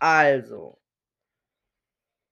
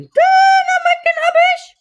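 much higher, louder squeaky cartoon voice; 15 dB louder than usual. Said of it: "Heute mit ganz hellen Tö-, Stimmen, Tönen".

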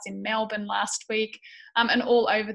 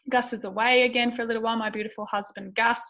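ordinary delivery

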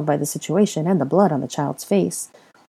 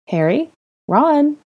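higher, louder questioning call on the second name; 11 semitones higher, 4 dB louder, slower than usual. Acted. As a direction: call to them from upstairs. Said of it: "a woman's voice called from above".

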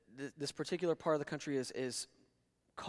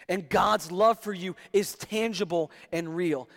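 loud, high, impassioned preaching; full-force preaching; 6 semitones higher, 10 dB louder than usual. Acted. Pastor driving home God's love to the congregation, loud and high. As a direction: preach it out to the room.

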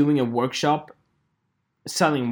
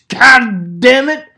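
loud, high, drawn-out curse; slow, hammed-up exasperated shout; exasperated outburst; 7 semitones higher, 9 dB louder, slower than usual. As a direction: blow up at the end of a rant. Climax of the rant.

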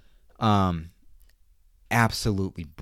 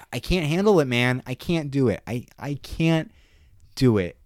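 overwhelmed outburst; 6 semitones higher, 5 dB louder than usual. Real, not acted. Overwhelmed by the show's violence.

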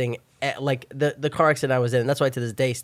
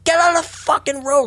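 high yell at a wandering friend; screaming; 14 semitones higher, 4 dB louder than usual. Retold or imitated. To his friend wandering into traffic, screamed order. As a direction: scream him back out of danger. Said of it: "screaming at him".

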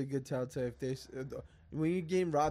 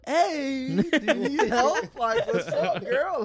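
higher and louder, laughing through a flirty offer; hammed-up smooth-talker offering help; 11 semitones higher, 7 dB louder, much slower than usual. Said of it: "I'm like".

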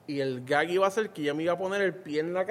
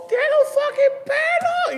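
much higher and louder, drawn out slowly; disgusted shocked yell; 8 dB louder, much slower than usual. Retold or imitated. Telling the crew he found a hair, shocked and shouting. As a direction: stretch it out in horrified disbelief.

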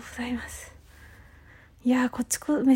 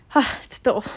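ordinary talk, nothing marked

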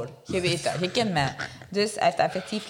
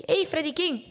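higher, friendly hail; calling out a greeting; 8 semitones higher than usual. Retold or imitated. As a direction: call his name across the square. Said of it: "Ik zeg".

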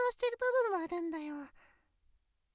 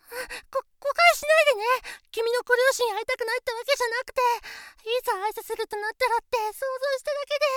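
higher, louder, fast panicked explaining; flustered rush; 3 semitones higher, 7 dB louder, faster than usual. Acted. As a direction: rush to fix the misunderstanding. Flustered denial rushing out.

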